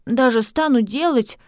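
put-on high, resolute girl voice; sigh, then insist like a child; stubborn little girl. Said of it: "с глубоким вздохом произнесла".

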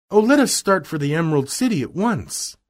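high, eager urging; Dorothy crying out. Acted. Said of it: "cried Dorothy".

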